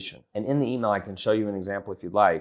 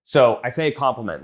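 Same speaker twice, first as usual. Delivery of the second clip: ordinary talk, nothing marked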